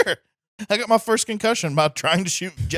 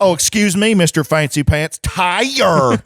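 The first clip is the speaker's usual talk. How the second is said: loud flamboyant sarcastic voice, drawn out; flamboyant mock; 6 dB louder, slower than usual. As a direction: camp it up with sarcasm. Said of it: "the gayest way possible".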